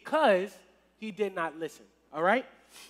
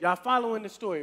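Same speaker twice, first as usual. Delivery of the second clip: usual speaking voice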